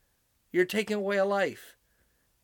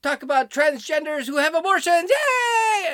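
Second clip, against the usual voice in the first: high, loud mock celebration; hammed-up fake yay; sarcastic cheering; 8 semitones higher, 6 dB louder than usual. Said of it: "Then they say".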